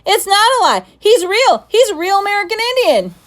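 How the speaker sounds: high, loud, rushing protest; boy insisting he is telling the truth, shouting. Acted. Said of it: "Patrick shouted suddenly".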